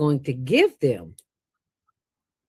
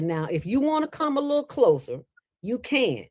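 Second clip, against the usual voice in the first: higher, firm warning to back off; a mild stern warning, a bit higher; 6 semitones higher than usual. Retold or imitated. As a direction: put him on notice, woman to man. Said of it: "And I said".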